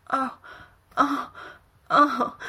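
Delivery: loud rising moans; moaning out loud. A narrator's reading. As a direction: let the moans build loud. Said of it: "He moans out loud".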